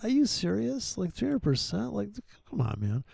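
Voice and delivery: high, incredulous mock rant; mocking disbelief. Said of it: "attacking this guy saying".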